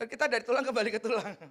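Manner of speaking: usual speaking voice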